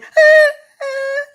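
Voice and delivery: a high, loud crying wail; mock wail. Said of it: "it's like".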